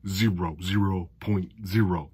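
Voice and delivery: a hint of the stern dean reading a grade; mild deadpan impression of the zero point zero line; Animal House quote. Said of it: "was it, uh, Animal House?".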